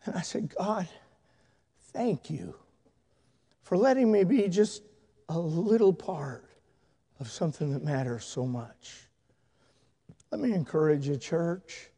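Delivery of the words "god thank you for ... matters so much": soft, halting thanks with long pauses; hushed tearful prayer, retold. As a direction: pray it quietly, as if recalling being overcome. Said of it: "I just broke down crying".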